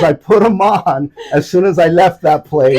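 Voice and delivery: loud, high, elated retelling; retold triumphant shout. Man reporting he put Crocs on, excited shout. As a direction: belt out the happy confession.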